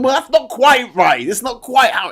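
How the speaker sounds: pedantic correcting. Pretend. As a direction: play a fussy know-it-all. Mocking himself as a pedantic corrector.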